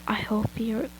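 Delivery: breathy villain whisper in the ear. Acted. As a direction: whisper it close, menacing. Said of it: "whispered right in my ear".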